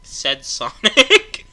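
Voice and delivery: said through laughter; laughing drawn-out rise. Real, not acted. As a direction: stretch it out, laughing. Mocking dialogue tag.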